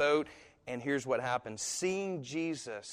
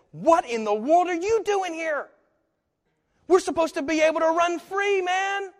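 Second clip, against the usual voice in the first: much higher, louder mocking demon voice; indignant demons; 9 dB louder than usual. Demons protesting to Jesus, high, loud mock squeal. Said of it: "and says".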